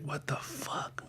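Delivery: slow, hushed whisper of confusion; whispered what the fuck. Retold or imitated. Waking up somewhere strange, whispering to himself. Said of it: "I was like".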